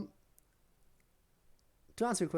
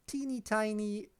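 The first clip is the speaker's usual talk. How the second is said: mock-cute, drawn out and high; sarcastic; 6 semitones higher, 3 dB quieter than usual. Real, not acted. Streamer mocking the "tiny" pandemic to chat, sarcastic. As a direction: play the pandemic down with heavy irony.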